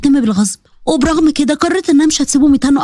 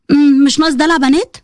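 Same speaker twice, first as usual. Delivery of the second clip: questioning repeat with a rising end; mocking echo. Bride repeating husband's rebuke back at him, mocking. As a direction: throw the words back as an offended question.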